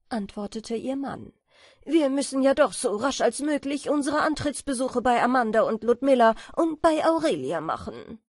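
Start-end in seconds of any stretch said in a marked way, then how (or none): 1.8-8.2 loud, hurried character voice; busy swallow husband. A narrator's reading. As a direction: plan the visits loudly and briskly. (geschäftiger Schwalbenmann, laut und eilig)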